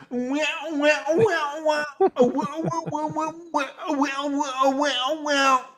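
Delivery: high, sing-song imitation of squealing guitar; mocking guitar sound; mock guitar noodling. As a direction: mimic a silly guitar riff vocally. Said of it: "he's like".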